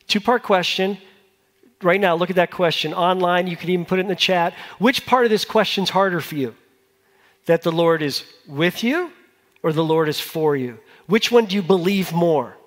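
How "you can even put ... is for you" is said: ordinary talk, nothing marked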